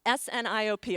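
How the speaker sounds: S N I O P, each letter said separately and loud; spelled out letter by letter. Shown as a demonstration. Speaker spelling the word for the audience, loud letter by letter. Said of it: "Zig Ziglar called 'em sniOps".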